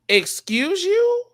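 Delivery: high, slow incredulous rise. Retold or imitated. Host reacting to a contestant naming two people, incredulous. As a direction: stretch it out in disbelief.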